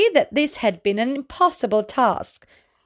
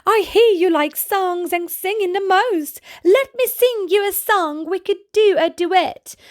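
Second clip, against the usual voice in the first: eager alligator; 9 semitones higher than usual. Acted. Alfie offering the buffalo a duet, high and eager. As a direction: pitch the song offer hopefully.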